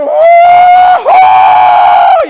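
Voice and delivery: long, very high, loud whoop; jubilant shout. Acted. Healed leper leaping as he runs back, jubilant shouting. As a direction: holler a drawn-out joyful "oh". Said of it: "y diciendo".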